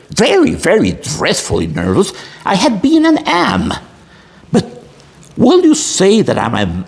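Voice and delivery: dramatic story reading. Acted. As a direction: read it like a storyteller gripping the audience. Dramatic reading of Poe's tale.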